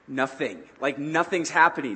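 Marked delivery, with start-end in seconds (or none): none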